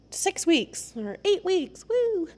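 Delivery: high, drawn-out fake excitement; slow singsong mock enthusiasm; mock hype. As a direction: parody a sales promise with a high woo. Mocks quick-fix programme hype.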